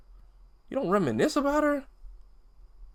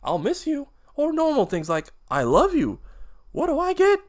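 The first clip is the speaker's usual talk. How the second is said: high, raised voice full of hurt; bitter tirade; 9 semitones higher, 5 dB louder than usual. Acted. P J to his father about leaving for college, bitter tirade. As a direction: build angrily towards the final question. Son lists what he never heard.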